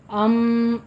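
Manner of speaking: अम with a long nasal hum on the म; tajweed demo. Shown as a demonstration. Teacher shows the ghunna. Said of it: "पहले ये गुन्ना करना है".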